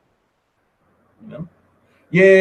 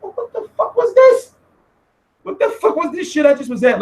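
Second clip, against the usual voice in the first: baffled disbelief; 11 semitones higher than usual. Retold or imitated. Watching own footage back at home, baffled disbelief. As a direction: react in mock shock, pitch way up.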